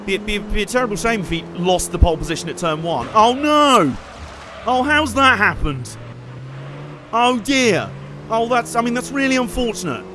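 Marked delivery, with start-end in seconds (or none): 3.1-10.0 mock sympathy. Mock sympathy after rival spins.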